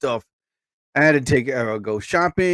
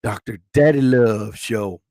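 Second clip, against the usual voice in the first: lower, smooth lover-man voice; romantic voice; 6 semitones lower than usual. Pretend. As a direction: croon it like a late-night love show host. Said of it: "the romantic".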